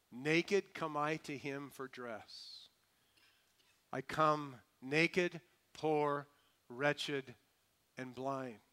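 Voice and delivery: very slow, word by word; slow, weighty hymn confession; solemn recitation. Acted. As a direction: let each word stand alone, falling away at the end.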